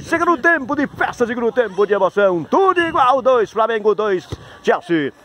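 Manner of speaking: high, ringing sportscaster call; high-pitched hype announcing; fanfare score announcement. Real, not acted.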